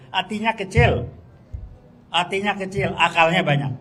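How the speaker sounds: real angry scorn; contemptuous outburst. Real, not acted. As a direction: spit out the verdict. Angry verdict on Jokowi.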